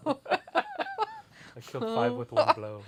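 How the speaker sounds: giant voice. Pretend. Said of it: "Like you're a giant".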